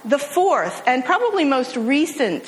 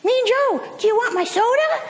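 put-on much higher, hopeful child's voice offering a gift; be the kid who won't give up; eager little boy; 13 semitones higher than usual. Boy offers his soda to the football star, high hopeful child voice. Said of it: "the kid is not to be deterred".

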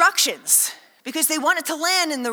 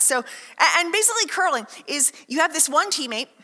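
ordinary talk

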